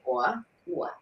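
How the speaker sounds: "or" as a rounded "waw", no R; New York vowel. Shown as a demonstration. Showing how New Yorkers sound, New York vowel. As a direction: drop the R in "or", low and rounded. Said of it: "New Yorkers say".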